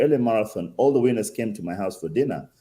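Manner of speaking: normal talking voice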